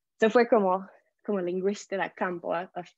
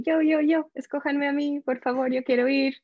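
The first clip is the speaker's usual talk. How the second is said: eager volunteering; 6 semitones higher than usual. Retold or imitated. Young teacher begging the school to choose her, high and eager. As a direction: beg like a kid waving a hand, pick me.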